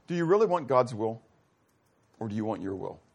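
ordinary talk

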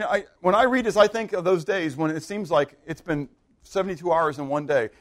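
usual speaking voice

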